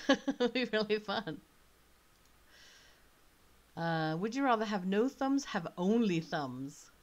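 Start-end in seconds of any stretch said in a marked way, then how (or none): none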